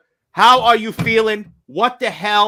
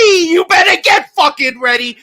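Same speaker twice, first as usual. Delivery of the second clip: loud, higher warning yell; hype shout; 7 semitones higher, 5 dB louder than usual. Real, not acted. Host warning listeners with a fired-up yell. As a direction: bark it at the crowd.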